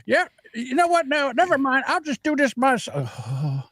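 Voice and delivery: high, exasperated mock voice; high whiny give-up voice; exasperated giving up. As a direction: throw up your hands and squeak it out. Voicing himself giving up.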